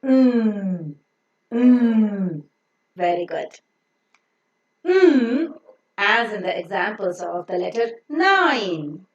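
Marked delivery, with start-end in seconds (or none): 0.0-2.4 "Mm" as a long hummed nnn; nasal N sound. Shown as a demonstration. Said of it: "the N sound as a nasal". 4.9-5.5 "Mm" as a higher, louder nnn; nasal N sound. Shown as a demonstration. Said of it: "the N sound as a nasal".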